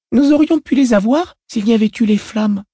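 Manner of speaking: put-on high little girl's voice; child voice. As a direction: speak as an uncanny, self-assured girl. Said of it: "commenta l'enfant".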